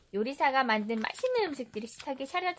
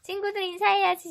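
higher, louder, quick urging; cheery prompt; 8 semitones higher, 5 dB louder, faster than usual. Real, not acted. Mother prompts greeting. (밝고 빠르게 재촉하는 말투)

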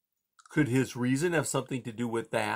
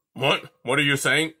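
sharp, louder demand; 2 semitones higher, 6 dB louder than usual. A narrator's reading. Porcupine demands what the mouse means, sharply. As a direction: press her for an answer. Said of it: "he demanded".

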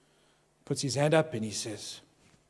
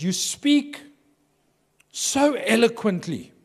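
high, slow, drawn-out praise; slow, high-pitched flattering compliment; admiring compliment; 7 semitones higher, 4 dB louder, much slower than usual. Retold or imitated. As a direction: gush it out slowly like a polite preacher. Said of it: "he goes".

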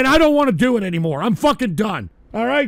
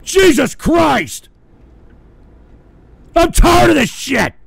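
louder, higher, shouted outburst; furious shout; 3 semitones higher, 5 dB louder than usual. Real, not acted. Streamer hating that he has to do the show, furious shout. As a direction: let the rage boil over for real.

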